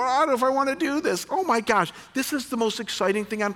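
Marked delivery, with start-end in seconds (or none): 0.0-1.3 hesitant listener. Retold or imitated. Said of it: "some of you go".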